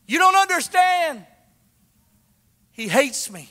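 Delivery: high, loud retold outburst; loud cry. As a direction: shout it through tears as the girl. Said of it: "real loud".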